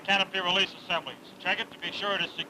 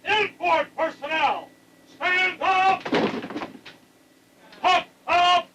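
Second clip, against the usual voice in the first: shouted jump commands; 9 semitones higher, much slower than usual. Real, not acted. Jump master giving jump commands.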